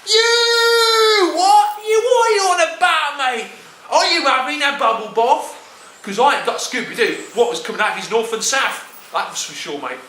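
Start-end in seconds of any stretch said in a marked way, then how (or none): 0.1-5.6 much higher, louder indignant cockney; indignant geezer. Retold or imitated. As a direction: fire it back in outrage, mate. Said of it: "I thought".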